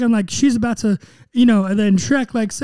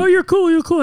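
high, excited mock fawning; eager sidekick; 10 semitones higher, 4 dB louder than usual. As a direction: gush it louder, in a raised pitch. Acting out fawning Donkey to Shrek.

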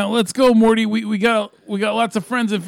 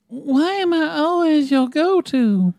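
put-on slow whiny teen girl; Summer voice; 6 semitones higher, slower than usual. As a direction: whine it slowly. Voicing Summer.

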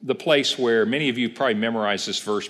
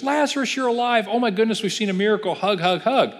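much higher, gushing mock joy; imitating overjoyed crowd; 9 semitones higher than usual. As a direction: play the gushing crowd hugging Lazarus. Crowd greeting risen Lazarus.

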